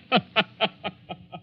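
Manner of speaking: high mad cackle. Acted. Villain laughs before leaving.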